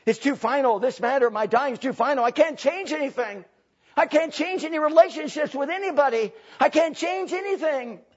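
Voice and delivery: acted regret, rising in pitch; regretful. Preacher voices the regretful dying person, rising regretful protest. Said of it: "A regretful attitude".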